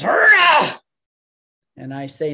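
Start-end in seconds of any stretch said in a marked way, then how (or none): 0.0-0.8 brutish cheer, much higher and louder; executioner's war cry. Acted. Executioner drawing his sword before the crowd, whooping. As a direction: let out a savage battle whoop. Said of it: "he pulls the sword and he goes".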